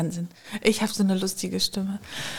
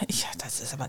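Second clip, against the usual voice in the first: whispering; 9 semitones lower, 12 dB quieter, faster than usual. Real, not acted. Unsure about headphone sound.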